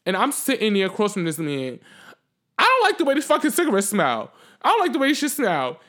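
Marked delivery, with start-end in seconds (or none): 2.6-5.8 raised, higher confrontational voice; acted complaint. Retelling her complaint to the man with the cigarette, acted confrontation. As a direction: re-enact calling out an offender.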